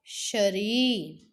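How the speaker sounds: शरीर very slow, रीर held long; teacher models शरीर slowly; drawn-out long ई. Shown as a demonstration. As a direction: stretch the ई in शरीर. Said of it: "ई करते समय इस पे ज़्यादा जोर दिया".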